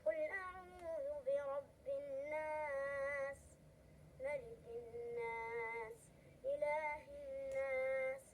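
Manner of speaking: slow, melodic Quran chanting; Quran recitation. Acted. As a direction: chant each verse slowly and evenly. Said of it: "حاب هذه، هذه الآيات".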